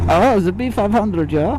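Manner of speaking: "B five hundred" in a light mock German accent, B said almost as P; playful put-on German accent on "B five hundred"; fake German accent. Rider naming the road to his viewers in a mock German accent. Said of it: "the fake German accent".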